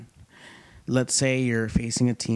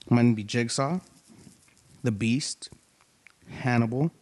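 ordinary talk

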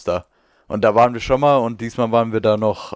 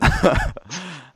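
high giggle; 8 semitones higher than usual. Real, not acted. Laughs at teasing.

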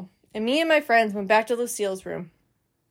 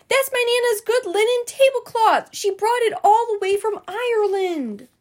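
put-on much higher, upset girl's complaint; hollering; 11 semitones higher than usual. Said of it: "hollered Lucille".

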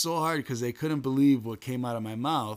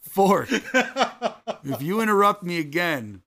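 loud, slow, higher mock threat; retold threat; 5 semitones higher, 6 dB louder, slower than usual. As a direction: threaten with laughing exaggeration. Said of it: "And I was like".

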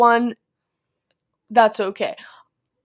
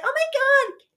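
much higher squealy mock gushing; imitating clingy girl. Mocking the girl who grabbed her leg, high squeal. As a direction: gush like an overexcited clingy classmate. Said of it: "be like".

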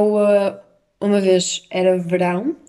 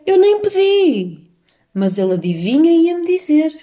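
indignant outburst; 8 semitones higher, 5 dB louder than usual. Real, not acted. Indignant retelling of the stranger.

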